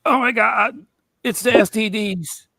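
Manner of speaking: high pained wailing; played-up agony. Acting out the chest pain.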